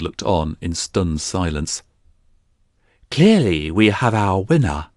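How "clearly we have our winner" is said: slow, lightly raised declaration; a hint of a ringside announcer; mild announcing cry. A narrator's reading. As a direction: proclaim the winner to the crowd. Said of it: "the priest cried".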